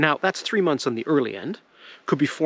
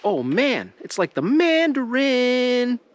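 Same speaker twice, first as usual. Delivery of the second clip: beetles voicing delight, slow and high; a hungry bug finding a feast, drawn out; excited beetle voice; 9 semitones higher, slower than usual. Beetles finding a fallen tree, excited bug voice. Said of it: "the beetles and the insects go".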